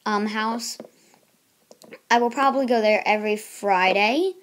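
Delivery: usual speaking voice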